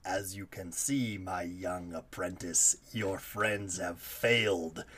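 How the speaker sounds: slow, menacing evil emperor; drawn-out sinister villain voice; Emperor Palpatine. Acted. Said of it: "It's just Emperor Palpatine".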